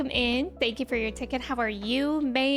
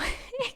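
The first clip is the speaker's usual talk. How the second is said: short laugh; 11 semitones higher, 4 dB quieter than usual. Real, not acted. Laughs mid-sentence about sleep.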